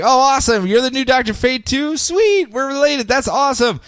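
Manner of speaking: high, chipper superhero uncle; excited Kent. Retold or imitated. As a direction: gush like an overeager hero. Kent voiced, excited retelling.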